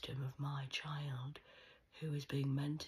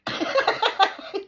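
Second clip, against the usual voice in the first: big mocking laugh; 17 dB louder than usual. Real, not acted. Mocking the tiny bike.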